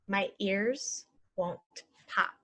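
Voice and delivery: slow and even; slow model. Shown as a demonstration. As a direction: say it slowly for learners. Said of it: "normal then slow".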